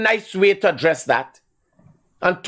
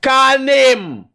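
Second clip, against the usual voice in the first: loud, raised demand; angry shout; 7 semitones higher, 5 dB louder than usual. Real, not acted. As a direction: bark it at the stalling caller. Host repeats angry demand.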